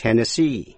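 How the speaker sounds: Tennessee with SEE stressed; stress on last syllable. Shown as a demonstration. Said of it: "Nhấn mạnh vào vần cuối C".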